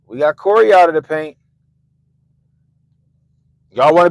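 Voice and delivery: loud, commanding threat from the party leaders; bossy party bosses. Retold or imitated. Party leaders threatening members into line, loud and bossy. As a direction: lay down the law like a mob boss. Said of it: "They're like".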